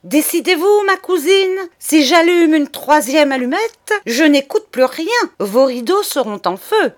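loud, high, urgent threat; ultimatum pressant. Acted. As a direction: push her to decide at once. Charles gives an ultimatum. (menace pressante, forte et aiguë)

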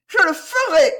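very high, loud, vehement vow; shrill resolve. A narrator's reading. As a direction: swear it shrilly, rising in heat. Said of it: "elle dit d'une voix aigrelette". (promesse aigrelette et véhémente)

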